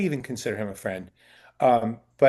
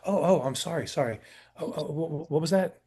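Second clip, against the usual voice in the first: flustered apology; 3 semitones higher, 4 dB quieter than usual. Retold or imitated. Chastened actor apologises, flustered.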